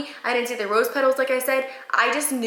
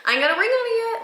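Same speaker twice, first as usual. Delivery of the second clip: teasing whine rising higher; mock whiny demand for a ring; joking nag; 8 semitones higher than usual. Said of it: "Like, just joking around".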